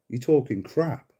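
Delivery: usual speaking voice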